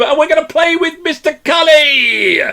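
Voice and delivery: high, hollered contestant introduction; hyped-up, high announcer yell; game-show announcer shout. Pretend. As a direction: call the player up like a showman. Host announces the contestant like a showman.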